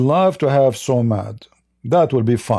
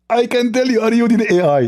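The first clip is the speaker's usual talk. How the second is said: high-pitched, lisping mock debater; Zakir Naik; 9 semitones higher than usual. Host mimicking his debate opponent, high lisping impression. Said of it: "I can speak like him".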